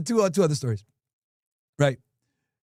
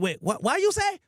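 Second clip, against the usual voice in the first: high, startled imitation; a lightly voiced shocked shop owner; imitated shocked owner; 9 semitones higher than usual. Shocked owner on the phone, voiced high. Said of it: "the Chinese guy's like".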